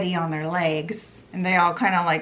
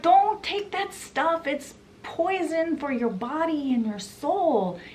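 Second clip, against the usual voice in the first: mock yell; 8 semitones higher than usual. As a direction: imitate a man pleading with a crowd. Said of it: "he yells out".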